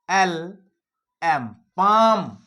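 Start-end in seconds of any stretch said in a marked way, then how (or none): 1.8-2.4 पाम said slowly and loudly as paam, no L sound; clear word demo. Shown as a demonstration. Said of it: "एक आवाज बता रहा हूं".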